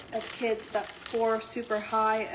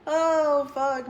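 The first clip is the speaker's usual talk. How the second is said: loud, higher exclamation; loud outburst; 5 semitones higher, 9 dB louder than usual. Real, not acted. Reacting to the viewers with a loud outburst. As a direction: blurt it out loudly.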